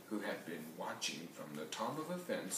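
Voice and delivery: ordinary delivery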